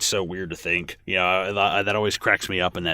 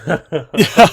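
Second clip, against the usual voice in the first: laughing; 10 semitones higher, 12 dB louder than usual. Real, not acted. Laughing at Ed's funeral joke.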